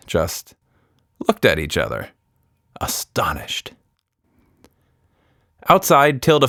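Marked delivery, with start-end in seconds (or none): none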